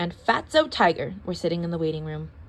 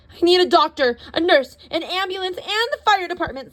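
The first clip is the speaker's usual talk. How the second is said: overdramatic; 9 semitones higher, 5 dB louder than usual. A narrator's reading. Panicked demands, overdramatic.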